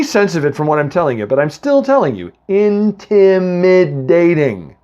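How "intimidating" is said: Intimidating said very slowly and weighted; drawn-out emphasis. Shown as a demonstration. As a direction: stretch out Intimidating slowly, syllable by syllable. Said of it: "I swear he was intimidating".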